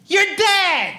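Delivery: high, sudden yell; abrupt, punched game-over cry; triumphant yell. Acted. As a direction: cut them off with a high-pitched shout. Robot cuts off the slow human.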